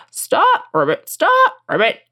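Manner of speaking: high frog yelps broken by ribbits; crying-out frog. A narrator's reading. Frog cries after fleeing princess, high frog call. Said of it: "cried the frog".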